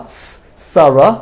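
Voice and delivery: isolated s, then "সারা" slowly; single s sound. Shown as a demonstration. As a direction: demonstrate "সারা" starting with a plain s, not sh. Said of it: "single এস এর মতো করে".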